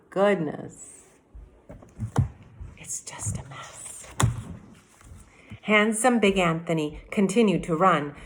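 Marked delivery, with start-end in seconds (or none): 2.8-4.1 hushed whispered aside; whispering. Real, not acted. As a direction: drop to a whisper. Teacher aside on story mess.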